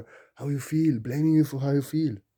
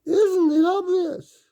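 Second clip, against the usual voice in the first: much higher, slower and louder isn't it obvious, squeaked out; cartoonish high-pitched emphasis; shrill exaggerated; 11 dB louder, slower than usual. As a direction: overdo the question like a mocking squeal. The repeat, exaggerated.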